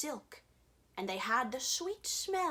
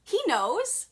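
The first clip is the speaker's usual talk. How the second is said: high, eager and loud; excited urging; 8 semitones higher, 6 dB louder than usual. A narrator's reading. Narrator urging the reader to ask, eager. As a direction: urge the listener on eagerly.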